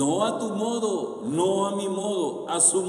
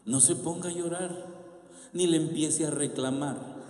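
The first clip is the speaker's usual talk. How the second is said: normal talking voice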